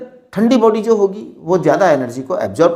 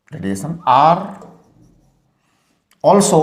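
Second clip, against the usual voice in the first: very slow, drawn-out words with long gaps; slow dictation; 5 semitones lower, much slower than usual. Shown as a demonstration. Teacher dictating the law to students, slow and measured. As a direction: dictate it word by word for writing down.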